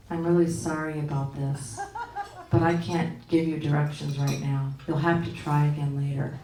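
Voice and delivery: monotone, low and soft insincere apology; Siri. Retold or imitated. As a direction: drone an apology without meaning a word. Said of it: "she says in this monotone".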